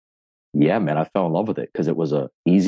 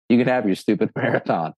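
laughing; 5 semitones higher than usual. Retold or imitated. Retelling refusing friend's marathon challenge.

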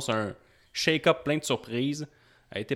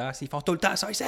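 angry fan; 8 semitones higher, faster than usual. Retold or imitated. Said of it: "qui était bien fâché".

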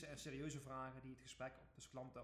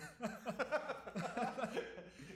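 loud laugh; 9 semitones higher, 6 dB louder than usual. Real, not acted. Keeps laughing about video tapes.

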